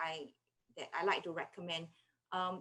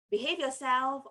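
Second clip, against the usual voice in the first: raised, higher parental command; mock scolding; 6 semitones higher, 7 dB louder than usual. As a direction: order a child to behave, louder. Parent's scolding commands voiced as example.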